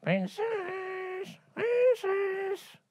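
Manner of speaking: high, eerie creaky call; ghostly frog calling at door. Acted.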